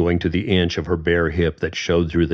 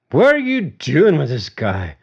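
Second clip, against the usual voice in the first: put-on loud, higher, slurring drunk; drunken slur; 8 semitones higher, 5 dB louder than usual. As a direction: slur it like a drunk picking a fight. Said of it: "James slurred".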